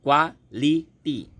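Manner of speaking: Quality slow, three separate falling beats; syllable by syllable. Shown as a demonstration. Teacher drilling three syllables, quality split slowly. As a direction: pause after each syllable of quality. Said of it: "ये तीन शब्द है".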